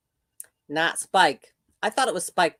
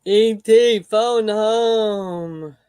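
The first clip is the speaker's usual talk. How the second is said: louder, very slow alien croak imitation; 3 semitones higher, 6 dB louder, much slower than usual. Host hamming up E.T. for viewers, loud and slow. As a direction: draw it out loud like E.T.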